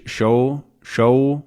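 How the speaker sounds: SHORE said as "show"; R dropped. Shown as a demonstration. Teacher feeling out non-rhotic shore, dropped R. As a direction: end SHORE on a round vowel with no R. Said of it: "lack of an R".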